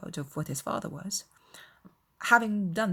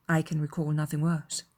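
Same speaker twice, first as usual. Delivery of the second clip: ordinary delivery, nothing marked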